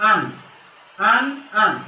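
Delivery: আন repeated, ending on a clear n; nun sakin sound. Shown as a demonstration. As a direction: drill আন with a closed n. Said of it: "নয় হসন্ত, নয় হসন্ত".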